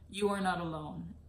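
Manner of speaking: normal talking voice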